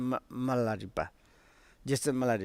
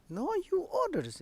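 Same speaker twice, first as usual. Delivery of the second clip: higher, softer, quick pleading; a mock tearful plea, voice pushed up high; tearful protest; 13 semitones higher, 7 dB quieter, faster than usual. Disciple pleading with his harsh teacher, softer and higher, mock tearful. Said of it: "He was cried".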